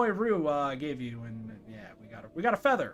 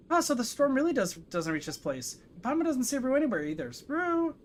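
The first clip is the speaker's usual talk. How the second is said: higher-pitched little game companion; Paimon; 8 semitones higher than usual. Acted. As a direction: pipe up in a higher character voice. Voices game character Paimon.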